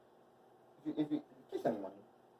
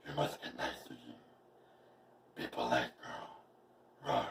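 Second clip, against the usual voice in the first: dog talk; 12 semitones lower, 4 dB quieter, slower than usual. Pretend. Cooing to her dog.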